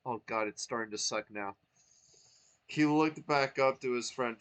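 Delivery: ordinary delivery, nothing marked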